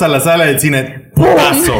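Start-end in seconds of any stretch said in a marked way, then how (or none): 1.1-1.7 much higher, louder sudden blow; much higher and louder hammed-up hit; mock punch shout. Mimicking movie hitting hard.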